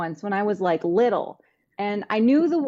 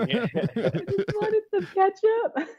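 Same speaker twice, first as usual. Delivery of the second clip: higher, drawn-out plea through laughter; pitched-up mock whine, slow and amused; mock kid's plea; 7 semitones higher, much slower than usual. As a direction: play the misunderstood kid, slowly, laughing. Acting the embarrassed kid's plea.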